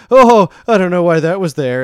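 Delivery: loud, high flustered outburst; mock embarrassed. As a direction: imitate a flamboyant, embarrassed man, loud and high. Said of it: "like, all embarrassed".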